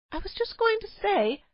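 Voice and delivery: high, timid protest; a hen-pecked husband getting a word in; meek protest. A narrator's reading. Caveman tries to answer his wife, meek high protest.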